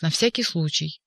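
usual speaking voice

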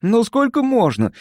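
heated, high-pitched protest. A narrator's reading. Impulsive Назарян losing patience with the hosts, heated. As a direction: impulsive outburst, pitched well up. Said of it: "горячился импульсивный Назарян".

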